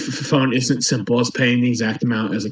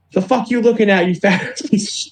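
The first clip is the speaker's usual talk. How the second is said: higher-pitched mock aggression; retold angry challenge; 7 semitones higher than usual. Homeless man turning on the store worker, mock belligerent challenge. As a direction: imitate a belligerent man picking a fight. Ranting man turns on the teller.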